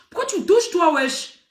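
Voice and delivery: high, sharp demand why she is touching; confronting the pushy girl at the club, high and indignant; indignant shout. Retold or imitated. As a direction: snap at her, fed up.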